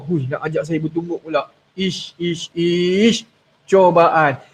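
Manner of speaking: ordinary talk, nothing marked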